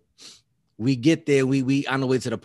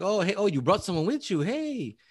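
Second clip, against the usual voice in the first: higher-pitched mock greeting; retold friendly greeting; 6 semitones higher, 3 dB quieter than usual. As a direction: imitate a woman's lilting hello, rising on the last hey. Retold woman greeting an unexpected guest.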